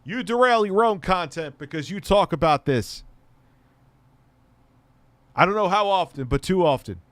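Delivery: loud, high-pitched scolding; exasperated calling out. Real, not acted. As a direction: shout the name, then keep scolding loudly.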